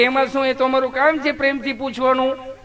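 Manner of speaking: reply like a nurse proud of her kindness; sweet nurse. Retold or imitated. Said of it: "તમે કેટલું પ્રેમથી પૂછો છો". (ઊંચા, મીઠા અવાજે નર્સ)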